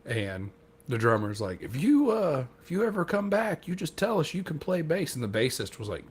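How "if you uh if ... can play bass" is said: high-pitched, imitated eager invitation; eager drummer impression. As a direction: pitch it way up, courting the visiting player. Drummer acted, eagerly inviting the visitor.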